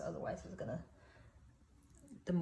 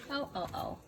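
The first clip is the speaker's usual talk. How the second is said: yelping in pain; 8 semitones higher, 6 dB quieter than usual. Real, not acted. Just scorched her hand.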